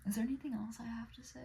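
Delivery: quick whisper to herself; fast whispered self-question; whispered aside. Real, not acted. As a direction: mutter it under the breath.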